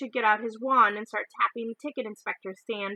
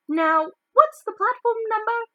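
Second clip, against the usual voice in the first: mother asking; 12 semitones higher, 7 dB louder than usual. Acted. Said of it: "said the boy's mother".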